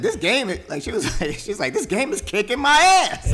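put-on high, loud sloppy drunk voice with laughter; drunk Blair imitation. Imitating drunk Blair to her friend, high and laughing. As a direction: slur it like a tipsy girl losing a game.

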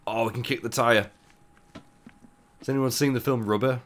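ordinary talk, nothing marked

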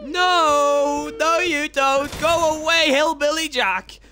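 high, loud desperate yells; panicked yelling. Real, not acted. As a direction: scream at him to go away. Chased by Hillbilly Jack.

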